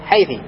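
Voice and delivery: حيثي pronounced haythi, ending in "i"; final kasra. Shown as a demonstration. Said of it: "وبعضهم ينطق إيش؟".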